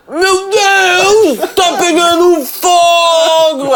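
put-on high, slow, slurred drunk cry; town drunk. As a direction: imitate a startled drunk, drawn out. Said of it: "Eu ia imitar o bêbado aqui".

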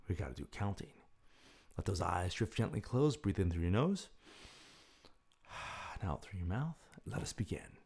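soft, slow counting of breaths; guided breathing count. Acted. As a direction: count the breaths calmly and softly.

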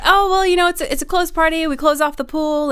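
high, sugary explaining; forced politeness. Retold or imitated. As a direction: let her down in an over-polite high voice. Polite explanation acted to pestering girl.

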